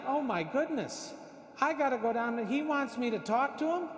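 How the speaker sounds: retold dismay, high and mounting; mock panic.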